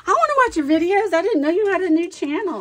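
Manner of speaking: high, loud and fast eager reply; excited friend imitation. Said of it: "She's like".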